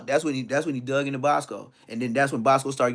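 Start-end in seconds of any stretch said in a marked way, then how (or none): none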